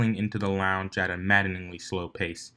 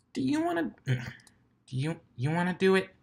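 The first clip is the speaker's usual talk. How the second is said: put-on much higher, stumbling young voice; hesitant Ash; 10 semitones higher than usual. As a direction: ask nervously, tripping over words. Ash's hesitant question.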